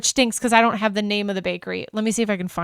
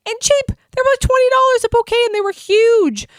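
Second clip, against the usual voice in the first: much higher, louder, thrilled; excited gush; 13 semitones higher, 5 dB louder than usual. Real, not acted. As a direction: squeal over how cheap and big they were. Gushing about cheap huge bouquets.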